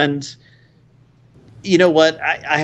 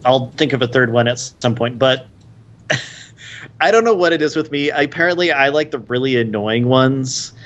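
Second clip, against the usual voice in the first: normal talking voice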